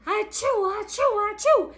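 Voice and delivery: three quick high achoos in a row; high, rapid sneezing; repeated acted sneezes. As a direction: fire off a run of storybook sneezes. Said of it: "He blows and he seizes".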